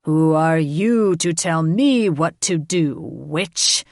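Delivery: loud snarl of a dragon king. A narrator's reading. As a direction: snarl at the intruder. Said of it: "Tormund arched back and snarled".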